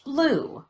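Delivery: Blue starting high and falling; obvious pitch change. Shown as a demonstration. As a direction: let the pitch drop clearly through Blue. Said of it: "Listen for that change in pitch".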